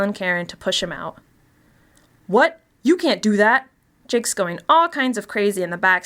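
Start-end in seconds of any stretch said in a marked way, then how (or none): 2.3-3.7 shocked protest. Acted. Protest at pushing him out.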